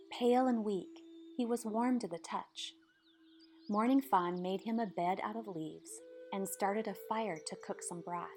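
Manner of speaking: ordinary delivery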